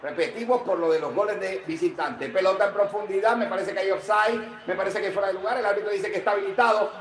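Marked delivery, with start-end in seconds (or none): none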